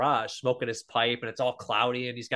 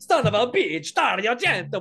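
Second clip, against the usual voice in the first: put-on higher, louder Italian-accented curse, laughing; mock Italian curse; 8 semitones higher, 5 dB louder than usual. Fulci cursing his rival Argento, mock Italian. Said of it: "but he says in Italian".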